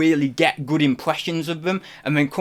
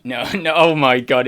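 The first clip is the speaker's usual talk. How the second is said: ordinary talk, nothing marked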